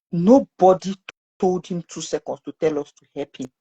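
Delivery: ordinary delivery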